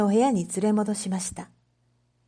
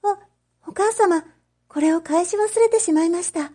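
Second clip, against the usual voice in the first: put-on high, stammering girl's voice, a little breathless with nerves; a lovestruck daughter covering her tracks, stumbling on the first word; flustered young girl; 8 semitones higher, 4 dB louder than usual. Oshichi to her mother, hiding her excitement: high flustered girl. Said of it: "お七はそう言って".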